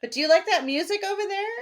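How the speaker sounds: high, quick, eager question; eager girl. Retold or imitated. Girl pressing him about the music, high and quick. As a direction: chase him with a bright question. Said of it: "she asks him".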